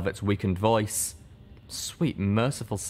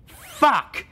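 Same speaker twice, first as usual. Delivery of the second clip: much higher, loud furious outburst; frustrated curse; 7 dB louder than usual. Acted. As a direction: explode in frustration.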